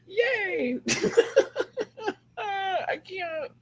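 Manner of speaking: high giggling cheer; laughing fit. Real, not acted. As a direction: break into happy giggles.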